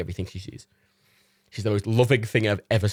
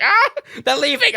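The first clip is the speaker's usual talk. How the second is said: very high, loud, frantic squeal; panicking dog voice; 7 dB louder than usual. Retold or imitated. Dog Matilda panicking as her owners leave, squealing very high and loud. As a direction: wail it like an abandoned puppy. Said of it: "my impressions of Reagan and Matilda".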